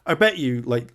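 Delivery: ordinary talk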